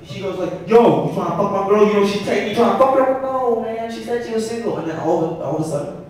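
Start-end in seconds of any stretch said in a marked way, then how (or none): 0.7-3.2 high, loud jealous challenge; hammed-up shouted accusation; mock yelling. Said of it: "We kept yelling at each other". 3.2-4.8 high-pitched defensive protest; mock yelling back. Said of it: "We kept yelling at each other".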